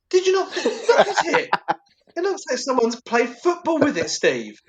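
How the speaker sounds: high, slow incredulous telling-off; mock outrage at coworker Steve; laughing exasperated scold. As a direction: scold him while cracking up.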